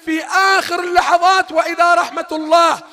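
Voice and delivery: loud, high, impassioned exhortation; fiery preaching. Real, not acted. Preacher urging believers not to despair, shouting. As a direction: call out to the faithful with fire.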